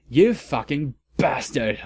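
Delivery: plain reading voice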